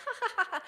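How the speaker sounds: high mock baby giggle; imitating baby laugh. Copying the baby in the meme, high giggle.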